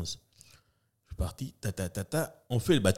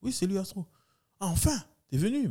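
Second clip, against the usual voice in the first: relieved director; 10 semitones higher than usual. Retold or imitated. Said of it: "Le réal".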